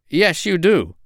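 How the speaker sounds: high, insistent contradiction; scolding duck. A narrator's reading. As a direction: contradict him as a bossy duck.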